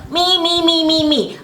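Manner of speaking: high, whiny me-me-me; whining voice. Retold or imitated. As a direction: mimic a self-absorbed whiner. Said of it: "I'm not gonna do the whining voice".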